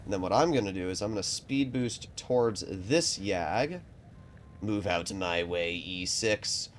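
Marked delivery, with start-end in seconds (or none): none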